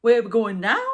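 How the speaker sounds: put-on a loud, jokey Geordie accent. Imitating someone for the Geordie viewers, loud Geordie accent. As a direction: belt it out in broad Geordie. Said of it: "but in a Geordie voice".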